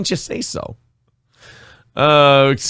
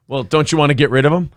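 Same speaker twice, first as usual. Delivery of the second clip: ordinary delivery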